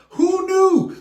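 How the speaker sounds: mock surprise. Real, not acted. Reader reacts to the twisted fairytale, mock amazed. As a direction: feign amazement.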